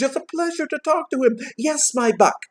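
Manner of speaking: high, tickled exclaiming; delighted Scrooge. A narrator's reading. Scrooge charmed by the boy, delighted.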